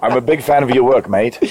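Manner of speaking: laughing through mock British; bad British accent. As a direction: put on a jokey British accent. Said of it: "fall into a bad British accent".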